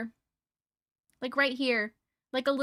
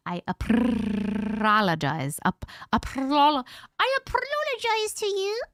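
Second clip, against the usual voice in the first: mock apology; 2 semitones higher, slower than usual. Hammed-up apology to the viewers.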